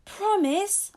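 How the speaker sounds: put-on a child begging for a promise; hopeful. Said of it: "suddenly feeling happy again".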